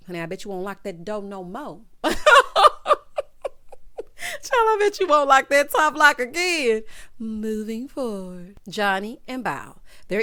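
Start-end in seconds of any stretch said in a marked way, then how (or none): 1.9-4.4 high loud laugh. Real, not acted. Cracking up at her own joke, laughing high and loud. 4.4-6.8 high, loud, tickled holler; loud teasing. Real, not acted. Ribbing a cheater about the lock, loud and teasing. Teasing after laughing hard.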